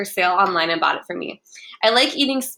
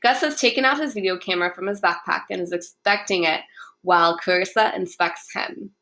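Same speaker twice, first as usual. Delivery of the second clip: usual speaking voice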